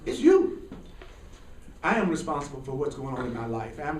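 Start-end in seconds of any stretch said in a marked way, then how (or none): 0.1-0.6 mock blaming finger-point. Mocking self-righteous blame.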